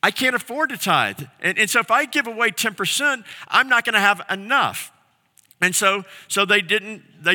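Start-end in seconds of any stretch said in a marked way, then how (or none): 0.0-4.9 higher, quicker voice of people making excuses; lightly voiced anxious Israelites; worried excuse. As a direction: whine that you can't afford it.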